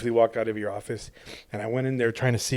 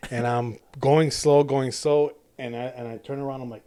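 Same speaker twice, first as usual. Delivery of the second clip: ordinary talk, nothing marked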